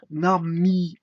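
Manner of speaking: "Nami" loud, in two held syllables; two-syllable demo. Shown as a demonstration. Teacher counting two syllables, "Nami" drawn out. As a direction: separate "Nami" into na-mi firmly. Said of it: "Deux syllabes".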